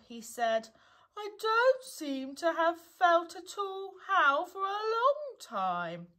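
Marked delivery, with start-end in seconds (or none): 1.2-6.1 high, drawn-out doleful moan; gloomy. A narrator's reading. Eeyore telling Pooh how he feels, gloomily. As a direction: wail it wearily, louder at the start.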